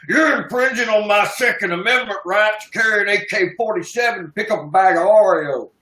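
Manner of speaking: high put-on American gun-nut voice; mocking gun nut. Mocking American gun fans to Steve, high put-on voice. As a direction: parody a whining gun rights fanatic. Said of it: "Sounds like one of those freaks say".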